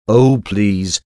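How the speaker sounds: ordinary talk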